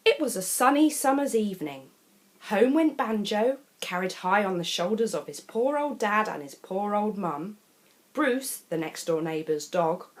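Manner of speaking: plain reading voice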